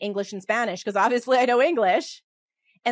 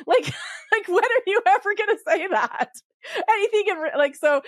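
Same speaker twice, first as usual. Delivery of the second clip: high-pitched, laughing through the words; 11 semitones higher, 4 dB louder than usual. Real, not acted. Joking to viewers about language programs, laughing high. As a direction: crack up at how absurd it is. Mocking a useless textbook phrase.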